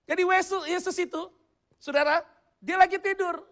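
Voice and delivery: loud, high scripture exposition; forceful preaching. Real, not acted. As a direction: project to the whole congregation.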